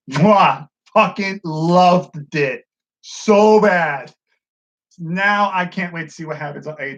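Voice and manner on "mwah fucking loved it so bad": slow, drawn-out gush of love; relishing the ending; chef's kiss gush. Real, not acted. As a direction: savor every word like a kiss. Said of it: "chef's kiss delight".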